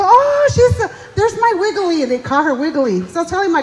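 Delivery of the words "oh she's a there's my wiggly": put-on high, delighted child voice, louder; kids' excited squeal. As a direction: squeal it like an excited little kid. Kids greeting grandma excitedly.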